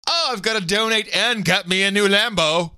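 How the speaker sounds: ordinary talk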